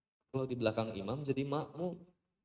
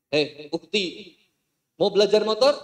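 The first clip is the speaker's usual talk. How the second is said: mock ustaz; 6 semitones higher, 11 dB louder than usual. Ustaz voiced flirting.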